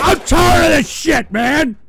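loud, fed-up yell at the chat; real shouted complaint; angry shout. Real, not acted. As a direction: blast it out, fed up. Ranting at trolls on stream.

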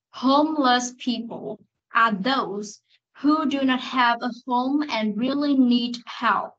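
slow, careful model reading. Shown as a demonstration. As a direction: read each word slowly for copying. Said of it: "Cô đọc lại lần cuối". (đọc mẫu chậm)

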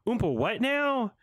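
high-pitched baffled echo; hammed-up incredulous question; mock disbelief. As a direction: mimic the line with exaggerated confusion. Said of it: "that's what I'm reminded of".

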